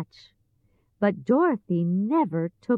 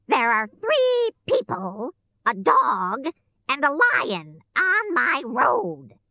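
high, commanding witch voice; a bossy witch giving orders; wicked witch; 6 semitones higher than usual. A narrator's reading. Witch to her monkeys, imperious.

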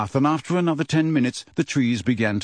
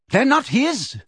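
high-pitched, contemptuous interjection; 12 semitones higher than usual. A narrator's reading. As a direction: cut in with open scorn. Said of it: "interrupted Bane contemptuously".

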